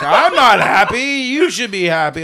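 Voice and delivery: high, loud retorting yell; mock angry wife. Wife yelling back at her husband over the body. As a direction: play the wife snapping back. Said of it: "And she's like".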